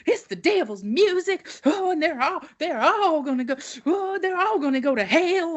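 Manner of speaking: high, quavering hammed-up preacher; teen mocking her preacher's rock-and-roll rant, high and hammed-up. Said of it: "And he's like".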